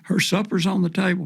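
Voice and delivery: retold yell. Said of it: "Pamela was screaming off the back porch".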